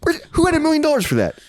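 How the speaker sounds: can't believe anyone paid that; high-pitched outraged disbelief; incredulous. Real, not acted. Outraged at the movie's budget.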